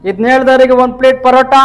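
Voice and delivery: called out loud and high to the class; loud playful dare to the students; loud teasing offer. Real, not acted. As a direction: announce the parota prize playfully.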